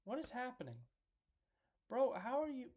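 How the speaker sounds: high-pitched, baffled complaint; exasperated disbelief. Real, not acted. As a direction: squeak it out in frustration at the screen. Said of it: "I'm sorry for yelling at you".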